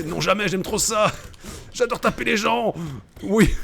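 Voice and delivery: high cheeky bully voice; gleeful brute. Acted. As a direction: relish hitting people. Voicing the gleeful attacker.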